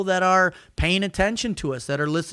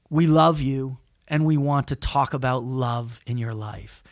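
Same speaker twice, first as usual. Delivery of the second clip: tender; 7 semitones lower than usual. Acted. Researcher surprising nervous test subjects, tender. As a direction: drop low and gently say it.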